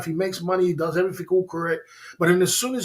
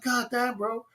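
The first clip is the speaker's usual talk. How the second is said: exclaiming; 7 semitones higher, 5 dB quieter, slower than usual. Retold or imitated. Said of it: "I'm like".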